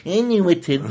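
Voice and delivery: lightly voiced know-it-all nerd; a hint of a smug super fan. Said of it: "that was my weird nerd voice".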